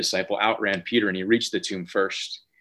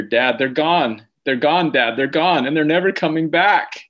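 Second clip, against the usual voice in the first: raised, rising mock wail of an upset little boy; imitating a tearful child; 6 semitones higher, 5 dB louder than usual. Son runs in to tell dad the treats are gone, mock tearful wail. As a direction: play a distraught kid, climbing higher and louder. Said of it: "literally on the verge of tears".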